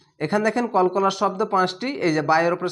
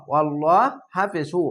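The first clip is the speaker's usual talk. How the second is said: ordinary talk, nothing marked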